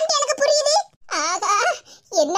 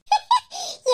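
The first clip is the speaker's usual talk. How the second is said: high gloating giggle; pleased high-pitched snicker; gleeful laugh; 8 semitones higher than usual. Acted. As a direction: laugh with glee at the mischief. Gloating alone after the fight.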